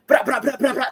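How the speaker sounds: high mock rapper gunshot noises; imitated gunfire ad-lib. Man mocking UK rappers, imitated gunfire ad-libs. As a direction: fire off parody rap gun sounds.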